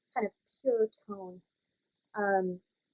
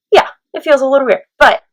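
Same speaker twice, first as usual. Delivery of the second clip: loud resonant voice; 4 semitones higher, 17 dB louder than usual. Shown as a demonstration.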